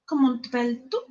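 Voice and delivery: measured French question; French accent. Shown as a demonstration. Showing Kitty Mum the accent, French question. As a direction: ask it in schoolroom French. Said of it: "Kitty Mum, the accent".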